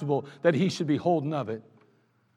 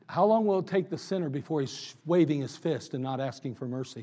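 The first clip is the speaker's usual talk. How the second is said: ordinary talk, nothing marked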